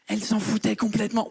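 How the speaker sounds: normal talking voice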